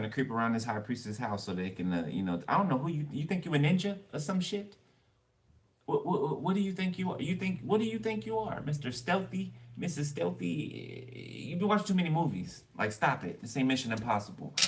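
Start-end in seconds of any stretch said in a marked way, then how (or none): 2.5-12.6 mocking scold. Scolding a sneaky intruder.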